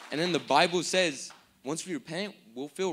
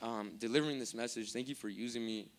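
lower, softer prayer of thanks; closing prayer; 3 semitones lower, 4 dB quieter than usual. Real, not acted. Preacher thanking God to close the message, quiet prayer. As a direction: pray quietly and humbly to God.

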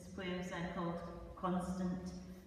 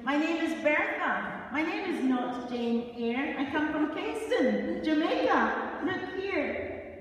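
higher, louder indignant shout; loud defiant cry, higher and higher; 9 semitones higher, 9 dB louder, slower than usual. Acted. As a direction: shout your name at them in protest. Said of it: "she was shouting".

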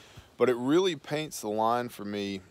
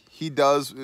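normal talking voice

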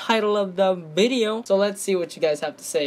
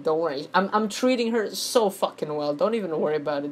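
ordinary talk

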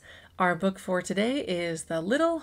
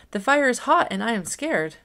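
higher and louder, a frightened little rabbit cry; scared rabbit; 4 semitones higher, 5 dB louder than usual. Acted. Rabbit telling the animals the fire is hot, mildly sobbing. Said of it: "sobbed the rabbit".

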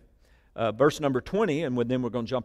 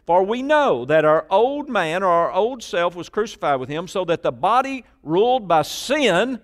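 forceful preaching; 7 semitones higher, 8 dB louder than usual. Real, not acted. Preacher declaring scripture to the congregation, forceful. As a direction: proclaim the verse at full force.

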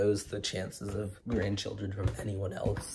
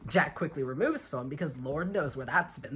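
ordinary talk

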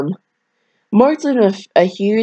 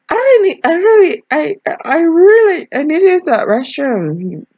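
Jack's voice; 10 semitones higher than usual. Acted. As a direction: pitch it up high and drag it out as Jack. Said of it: "I got that as Jack".

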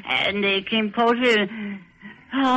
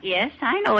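ordinary talk

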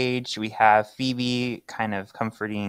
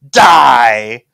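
loud mock yell; villain yell; 15 dB louder than usual. Valen stabbing at Phoebe, a loud mock villain yell. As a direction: spit out the threat. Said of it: "he's saying".

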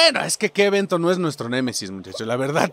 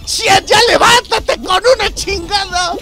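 furious yelling; 11 semitones higher, 6 dB louder, slower than usual. Real, not acted. Character knocked down.

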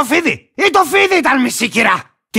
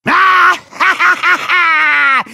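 gloating laugh; 4 semitones higher than usual. Acted. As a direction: laugh wildly over the beaten trespasser. Character gloats over beaten trespassers.

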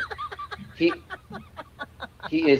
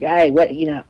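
loud, raised, half-attempted Boston accent; rough Boston accent; 7 semitones higher, 10 dB louder than usual. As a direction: badly imitate a Boston guy. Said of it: "I can't do the Boston accent".

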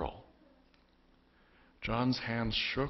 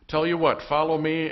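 raised, loud call from shore; 7 semitones higher, 8 dB louder than usual. A narrator's reading. Man on the shore inviting the fishermen, calling out. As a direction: call the invitation across the water.

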